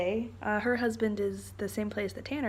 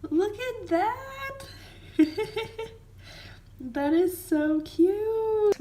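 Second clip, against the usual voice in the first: very high, slow cooing voice; 11 semitones higher, much slower than usual. Real, not acted. Cooing over a cute magnet.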